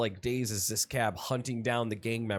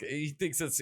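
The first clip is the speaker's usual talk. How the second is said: ordinary talk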